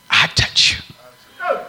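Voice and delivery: high, slow, breathless whisper of a frightened woman; timid woman owning up to Jesus in a whisper; fearful whisper. Acted. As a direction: confess it in a tiny scared whisper. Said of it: "she was so fearful".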